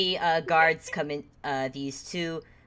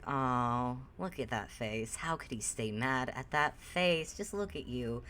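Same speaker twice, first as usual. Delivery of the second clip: low, soft doting murmur; softened; 5 semitones lower, 10 dB quieter than usual. Acted. Charmed man gazes at Ruby's face, softened. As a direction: melt at the sight of a cute kid. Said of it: "when suddenly his face softens".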